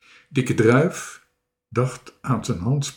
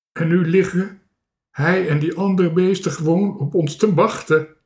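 higher, louder lament; wailing; 5 semitones higher, 5 dB louder than usual. A narrator's reading. Marinka is trapped in the tree, wailing. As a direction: complain in a plaintive wail. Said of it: "jammerde Marinka".

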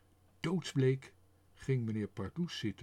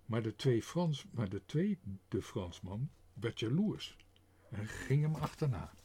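ordinary delivery

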